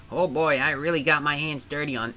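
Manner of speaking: second take at Luigi, pushed nasal; Luigi voice, too nasal. Acted. Streamer retakes Luigi, nasal overdone Luigi. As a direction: push Luigi through the nose, overdone. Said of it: "no, that's too nasally".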